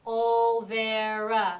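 "Olvera" very slow and loud, three separate beats; clapped syllables. Shown as a demonstration. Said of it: "Now we're going to count it".

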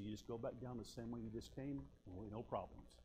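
low, hushed mock-confidential voice; retold whisper. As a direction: imitate a sergeant quietly encouraging a recruit. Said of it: "he whispered to me".

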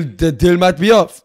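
put-on loud, high threat in French accent. French envoy threatening to cancel the deal, loud French accent. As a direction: threaten like a hammy French negotiator. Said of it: "Fuck this French accent".

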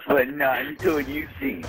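usual speaking voice

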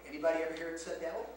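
usual speaking voice

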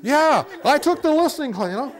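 high, loud easy agreement; cheery mock agreement. Shown as a demonstration. Counselor agreeing with the angry partner, loud and high. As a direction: agree brightly and let it pass.

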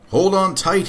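high, mock-roared call; understated storybook roar; mild roar. A narrator's reading. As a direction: call out a warning without real volume. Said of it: "he roared".

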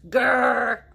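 loud playful growl; tiger growl. Acted. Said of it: "A tiger hunts for his lunch".

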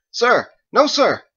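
loud, high military shout; parade-ground reply to the commander; barked at attention. Acted. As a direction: shout it like a drilled soldier. Corporal snaps to attention.

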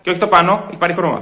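normal talking voice

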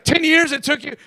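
high, loud scolding disbelief; acted-out exasperated outburst; incredulous rebuke. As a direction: scold him as if you can't believe it. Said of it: "he rebuked the guy".

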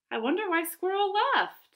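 high-pitched puzzled musing; wondering. A narrator's reading. Bat puzzled by Squirrel's absence.

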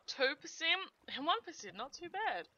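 ordinary talk, nothing marked

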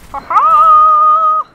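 high, shouted victory laugh; long crowing cry of triumph; loud triumphant ha-ha. Real, not acted. Triumph over enemies.

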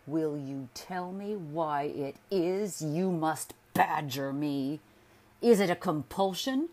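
loud, slow and hammering; husband demanding answers from his wife, harshly; peremptory demand. A narrator's reading. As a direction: badger her back, each question harder. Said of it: "he refused and demanded".

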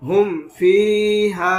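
"হুম ফিহা" slow, short clean m and long vowels; clear meem, no ghunna. Shown as a demonstration. Quran teacher showing izhar, slow and clear. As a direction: stretch "ফিহা", keep the m of "হুম" unhummed. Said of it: "গুণনা ছাড়া স্পষ্ট করে".

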